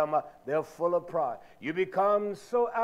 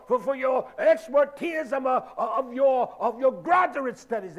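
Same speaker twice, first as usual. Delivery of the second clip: loud, high-pitched exhortation; full-force preaching; 5 semitones higher, 6 dB louder than usual. Acted. Preacher charging the congregation to lay down their lives, full-force preaching. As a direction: preach it hard, hammering each phrase.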